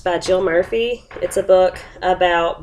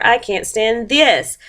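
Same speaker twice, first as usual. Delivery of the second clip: higher, slowed, "this" drawn out; stress on THIS; 4 semitones higher, slower than usual. A narrator's reading. Mister Bear woken by birds and sun, stressing "this". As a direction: drag "this" out wearily. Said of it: "it's all capital letters".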